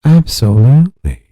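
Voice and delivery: a hint of a stiff, polished professional tone; mock professional voice. Shown as a demonstration. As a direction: put on a polite office voice, lifted and bright. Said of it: "I would use a little lighter tone".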